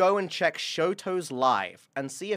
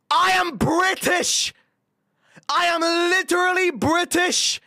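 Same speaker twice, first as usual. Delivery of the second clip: exasperated shouting; 12 semitones higher, 7 dB louder than usual. Real, not acted. Streamer mistaken for Shoto protesting to viewer, exasperated shout.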